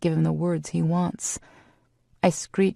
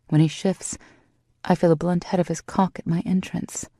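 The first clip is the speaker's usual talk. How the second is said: ordinary delivery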